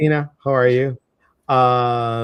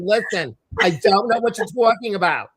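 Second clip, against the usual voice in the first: laughing mock Jersey; 8 semitones higher, 4 dB louder than usual. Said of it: "they were talking to me".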